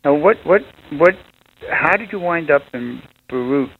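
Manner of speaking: ordinary talk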